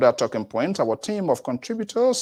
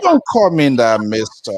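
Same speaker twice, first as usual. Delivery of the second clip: loud, playful don't-you-dare; half-joking, louder scolding of the guest; mock protest; 11 dB louder than usual. Host jokingly protests being called mister.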